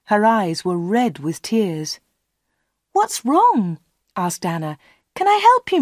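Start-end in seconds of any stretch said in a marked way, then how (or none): 2.9-3.8 high-pitched worried question; concerned. A narrator's reading. Anna asks the crying star. 5.1-5.8 high, anxious offer of help; concerned. A narrator's reading. Anna offers help to crying star.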